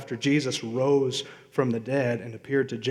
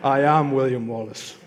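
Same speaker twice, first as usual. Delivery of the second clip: mock Scottish Braveheart accent, declaimed; a Scottish brogue, hero speech. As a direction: play William Wallace in a hammed-up Scottish accent. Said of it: "my Scottish accent from Braveheart".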